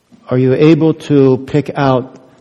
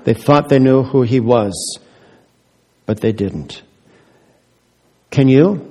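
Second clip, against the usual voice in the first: usual speaking voice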